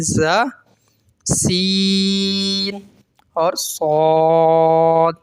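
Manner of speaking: "زا، سین اور صاد" with clear whistling sibilants; slow model reading of whistling "زا، سین اور صاد"; whistling letters. Shown as a demonstration. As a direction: stretch "سین" and "صاد" very long. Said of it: "تین حروف میں سیٹی نکلتی ہے".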